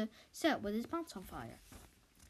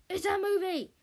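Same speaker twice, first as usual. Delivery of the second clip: barked; 9 semitones higher, 6 dB louder than usual. Acted. Director barking at Andy through his megaphone, loud. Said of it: "barked Mister Big Shot through his megaphone".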